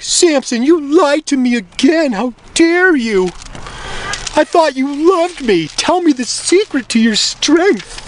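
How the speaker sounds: very high, slow mock-wounded wail; outraged Delilah. Delilah scolds again, more shrilly acted.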